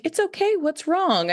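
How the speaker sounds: high, gentle coaxing voice; gentle comforting adult. Retold or imitated. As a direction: coax a frightened child to open up. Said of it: "somebody one day was just like".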